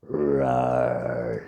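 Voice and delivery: low rumbling roar; bear roar. Acted. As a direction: roar from deep down. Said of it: "said the bear".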